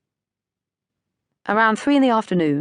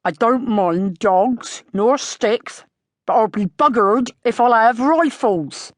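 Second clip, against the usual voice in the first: very slow then higher and louder; angry; 5 semitones higher, 5 dB louder, slower than usual. A narrator's reading. Farmer laying down the law on rifles, slow then angry. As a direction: drag it out, then blow up.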